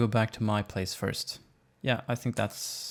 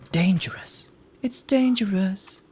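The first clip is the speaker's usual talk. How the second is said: dramatic repetition; 8 semitones higher, 6 dB louder, slower than usual. Acted. Repeating the word for drama.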